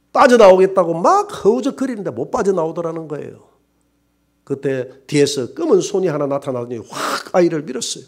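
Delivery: ordinary delivery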